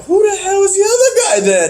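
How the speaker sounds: high, quick incredulous question; mock bafflement. Viewer learning the masked man's identity, mock bafflement. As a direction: squeak it out in total confusion. Acts own baffled reaction.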